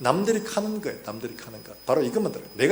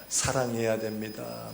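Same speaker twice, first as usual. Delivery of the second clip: slow, lowered mock-dignified preaching; measured, stately and pitched down; solemn pope; 4 semitones lower, slower than usual. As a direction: imitate a grave cleric giving moral counsel. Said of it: "아주 근엄하고".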